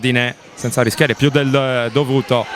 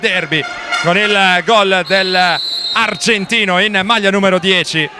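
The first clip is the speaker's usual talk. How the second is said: breathless Italian goal commentary; 4 semitones higher than usual. Real, not acted. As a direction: shout the goal, racing with joy. Goal call in live commentary.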